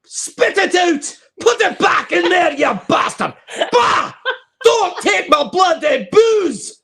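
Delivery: high, loud, slow hammed-up Scottish shouting; over-the-top Scottish bar rant; angry Scottish drunk. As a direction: rage at the fly like a furious Scottish drinker. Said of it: "The Scottish guy picks the fly up".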